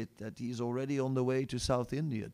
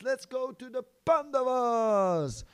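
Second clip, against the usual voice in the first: high, slow and eager; eager yes; 9 semitones higher, 3 dB louder than usual. Retold or imitated. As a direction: stretch it out with joy. Hanuman eagerly agrees.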